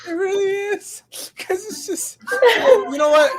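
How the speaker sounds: high squealing laughter through words; laughing hard. Real, not acted. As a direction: crack up mid-sentence. Cracking up at the picture.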